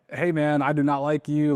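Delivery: high, emphatic rejection; lightly acted confrontational customer; hostile seller. Seller walking all over the caller, mildly hostile.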